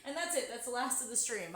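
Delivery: ordinary delivery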